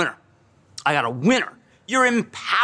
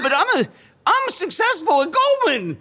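higher, thin, defensive self-imitation; high pitch; 10 semitones higher than usual. Retelling how he defended himself to his cousin, higher pitched. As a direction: squeak it out like a proud young banker. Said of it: "I was, like, talking with a high pitch".

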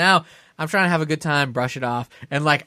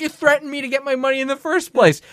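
high-pitched retort; retold aggrieved comeback; indignant accusation; 9 semitones higher than usual. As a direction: throw it back at him, pitched up. Arguing back at the carny.

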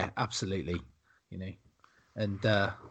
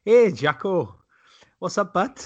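put-on Welsh accent; 7 semitones higher, 4 dB louder than usual. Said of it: "the Welsh fans going".

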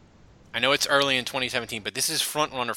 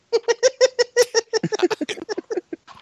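high, loud burst of laughter; big laugh; 7 dB louder than usual. Real, not acted. Reacts to the question.

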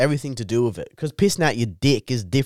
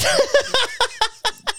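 short high squealing laugh. Real, not acted. Laughs mid rant.

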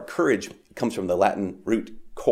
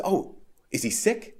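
high, slow, softly wondering question; innocent question; 8 semitones higher, 4 dB quieter, slower than usual. Acted. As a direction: ask it gently, pitched well up. Voicing the angel Clarence's question.

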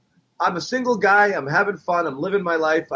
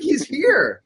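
excited; 11 semitones higher than usual. Retold or imitated. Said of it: "I was like".

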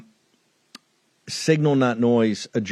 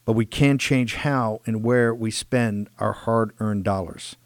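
slow, measured sponsor read; slow down and punch the brand name; slower than usual. Acted. Host urging listeners to begin their search, ad read.

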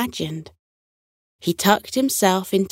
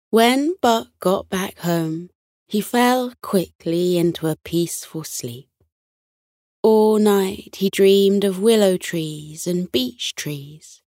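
ordinary delivery

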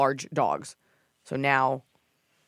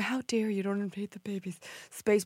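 mock whisper; 5 semitones higher, 6 dB quieter than usual. Mocking oversensitive people.

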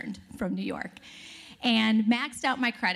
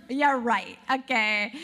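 sarcastic disbelief; 5 dB louder, slower than usual. Retold or imitated. Mocking suspected prank caller.

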